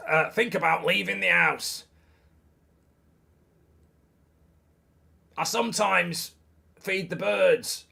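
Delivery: hammed-up gruff dad addressing his son; Tyson Fury's dad. Shown as a demonstration. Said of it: "sounding like Tyson Fury's dad".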